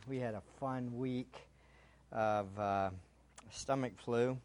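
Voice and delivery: dry sarcastic understatement. Real, not acted. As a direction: say it with deadpan sarcasm. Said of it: "That was sarcasm".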